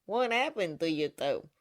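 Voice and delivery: ordinary delivery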